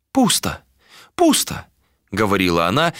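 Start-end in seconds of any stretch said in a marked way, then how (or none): 0.1-1.7 put-on high old seer's voice; old woman voice. A narrator's reading. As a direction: speak as the blind old crone, pitched up. Old seer voiced by narrator.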